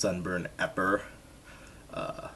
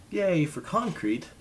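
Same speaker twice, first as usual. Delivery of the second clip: sarcastic cheer; 10 semitones higher, 4 dB louder, slower than usual. Real, not acted. Sarcastic cheer about hard ground.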